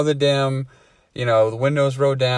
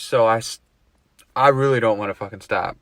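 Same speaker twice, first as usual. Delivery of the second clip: usual speaking voice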